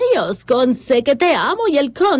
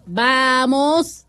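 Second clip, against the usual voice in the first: slow spelling dictation; 2 semitones lower than usual. Shown as a demonstration. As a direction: dictate each piece slowly, pausing on every espacio. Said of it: "Explícalo". (dictado lento, marcando cada espacio)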